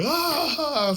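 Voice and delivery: high, loud mock scream. Thrown around in the truck, high mock scream. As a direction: let out a wild yell. Screaming during mud ride.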